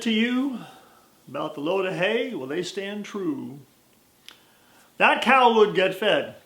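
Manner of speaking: put-on slow folksy old cowboy; lightly voiced old cowhand, unhurried; cowboy drawl. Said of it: "his Western drawl".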